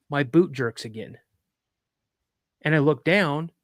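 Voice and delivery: plain reading voice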